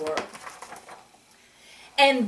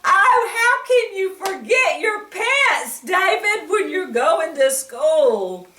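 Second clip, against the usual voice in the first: mock disbelief; 9 semitones higher, 5 dB louder than usual. Mock scolding David.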